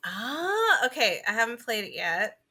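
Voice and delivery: ordinary talk, nothing marked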